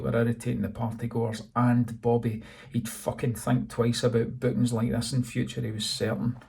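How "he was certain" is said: usual speaking voice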